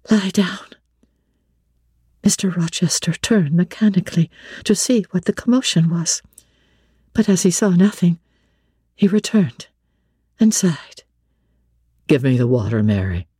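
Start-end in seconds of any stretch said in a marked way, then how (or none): 0.1-0.7 hushed, to the dog; soft whisper. A narrator's reading. Narrator soothing the excited dog, whispered. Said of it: "and said softly". 12.1-13.3 deep, weary blind master asking for water; low and tired, a brooding man; low Rochester voice. A narrator's reading. Blind master asking his servant, low and weary.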